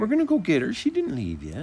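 high, swooping talk to a dog; pet-soothing voice. Real, not acted. Host reassuring a whining dog, in high pet-talk. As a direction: coo it high to comfort a pet.

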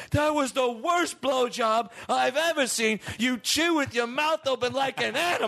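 high-pitched mock screaming tirade; Gordon Ramsay rant. Chef berating a partner on a sex tape, mock screaming. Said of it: "He would scream at you".

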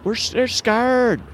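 high, drawn-out mock of a heckler; mocking imitation. Host mimics a woman shouting women are scared, mocking imitation. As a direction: draw the slogan out in a high, mocking call. Mocks the clip's shouted slogan.

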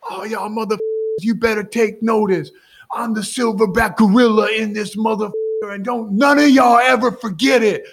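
loud triumphant athlete boasting to teammates and fans; trash-talking alpha. Retold or imitated. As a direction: roar at the whole plane like the silverback. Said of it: "He's like".

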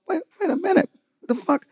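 high, startled mock outburst; retold disbelief. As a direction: imitate his stunned reaction to the drive. Retelling shock at friend's drive.

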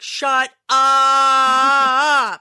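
loud, drawn-out mock yell; exasperated yell. Retold exasperated yell at son.

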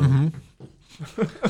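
humming through a mouthful of taco; mm-hmm with food in the mouth; mouth full. Real, not acted. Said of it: "I've got a mouthful of breakfast taco".

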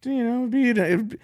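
high, slow mock-sweet menace; high-pitched creepy elderly impression; veiled-threat old man. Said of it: "He goes".

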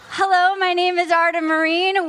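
usual speaking voice

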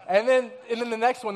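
usual speaking voice

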